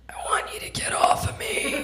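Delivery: scared little whispered plea. Retold or imitated. Tiny boyfriend pleading from under her, in a scared whisper. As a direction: whisper it, small and frightened.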